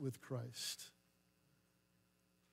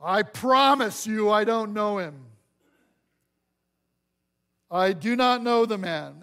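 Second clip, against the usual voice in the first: emphatic denial; 4 semitones higher, 9 dB louder than usual. Acted. Peter's oath of denial.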